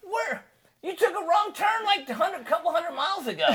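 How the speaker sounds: high, loud exasperated scolding; retold disbelief. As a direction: scold the driver, half laughing. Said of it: "It's like".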